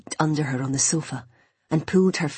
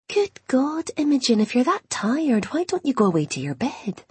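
higher, fussing mum; motherly Scottish voice; 8 semitones higher than usual. Acted. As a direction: scold gently like a worried mother.

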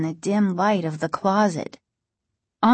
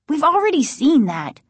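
higher, impatient retort; snapping; 7 semitones higher, 4 dB louder than usual. A narrator's reading. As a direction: snap back at a brother. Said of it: "Margaret snapped".

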